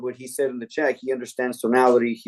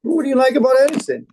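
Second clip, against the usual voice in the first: high, playful faux-naive asking; mock-innocent question; 11 semitones higher, 4 dB louder than usual. Salesman asking the ranting customer a stupid question, high mock-innocent voice. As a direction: ask it as if cluelessly sincere. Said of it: "I asked him a stupid question".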